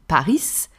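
Paris in French with a wrongly sounded final s; silent letter pronounced. Shown as a demonstration. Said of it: "pronounce the silent letter".

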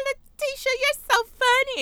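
high, giggly mock voice with a fake laugh. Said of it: "And they're like".

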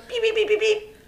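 high, rapid electronic beeps; imitated beeping. Imitating the headphone button for friends, high beeping. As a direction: mimic a string of high beeps.

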